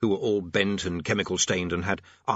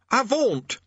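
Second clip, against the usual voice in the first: high, loud pompous command; boy playing commander; 5 dB louder than usual. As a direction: order the demon off in a boy's voice. Boy commanding demon with a sword.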